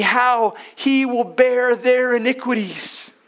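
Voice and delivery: preacher swelling with fervour; impassioned preaching. Acted. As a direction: build it up to the great love. Preacher building to climax.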